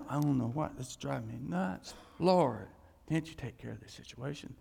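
low, worn-down plea; acted weary complaint. Acts out weary complaint to God.

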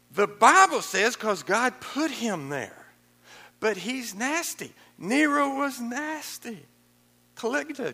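high, loud, slow preaching; preaching shout. Real, not acted. Preacher rousing the congregation, high and loud. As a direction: hammer each line out high and slow.

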